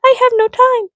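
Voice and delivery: mocking high whiny excuse; whiny viewer. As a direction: whine like a lazy excuse-maker. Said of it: "you're probably like".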